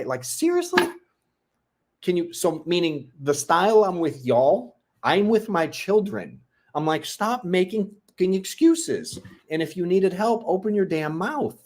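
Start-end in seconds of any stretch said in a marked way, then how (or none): 0.0-0.8 incredulous. Real, not acted. Dad ranting about son's excuses. 7.0-11.6 higher, slow, emphatic dad lecture; stern scolding. Retold or imitated. Dad shows how he scolds kids.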